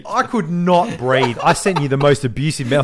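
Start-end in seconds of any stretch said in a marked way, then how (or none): 0.1-1.4 slow emphatic. Retold or imitated. Recalling burpees.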